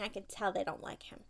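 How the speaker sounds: whisper. Real, not acted. Gossiping to her child about the vet staff in a whisper. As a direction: hush it like a secret.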